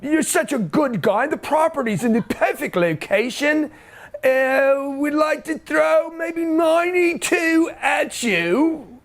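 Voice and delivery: high, slow, syrupy banker voice; sweet-talking banker. Pretend. Banker phoning Grant with a new loan, hammed-up and slow. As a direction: coax him like an eager loan officer. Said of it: "the bank's gonna call me up one day".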